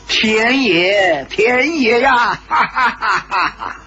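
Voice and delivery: drawn-out exclamation breaking into big laughter; roaring laughter. A narrator's reading. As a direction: stretch the cry, then guffaw. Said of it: "丁不四哈哈大笑". (拖长声调呼喊，继而哈哈大笑)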